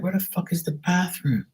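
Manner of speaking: high, hushed mock panic; lost and baffled, high but kept quiet; bewildered exasperation. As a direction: ask yourself in a strained, squeaky undertone. Acting out lost bathroom search.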